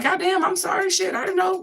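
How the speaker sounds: imitating a startled person saying sorry; mock flustered apology. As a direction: squeak out a caught-out apology.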